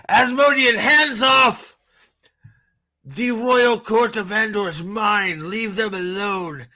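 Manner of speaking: hammed-up high, imperious command; Ravine. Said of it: "So Ravine's like".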